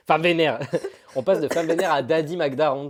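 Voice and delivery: laughing loudly. Real, not acted. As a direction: laugh through it. Laughing at the joke.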